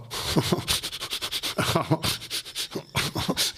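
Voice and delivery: wheezy snickering cartoon dog laugh; imitating a wheezing cartoon-dog chuckle; Muttley wheeze laugh. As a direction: hiss out a breathy Muttley snicker. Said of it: "like Muttley".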